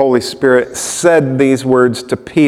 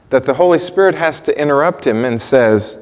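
ordinary delivery

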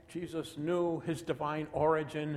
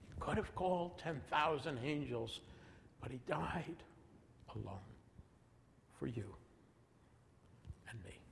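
soft, fading to barely audible; hushed reverence; 9 dB quieter than usual. Real, not acted. Preacher telling listeners Jesus died for them, hushed and fading. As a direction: say it softly, pausing between phrases and sinking away. Preacher repeats hymn line, moved.

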